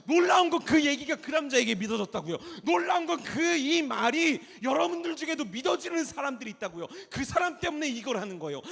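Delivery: high, loud, urgent sermon shout; full-force preaching. Real, not acted. Preacher telling young people God will work through them, shouting. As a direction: drive every phrase at the congregation at full volume.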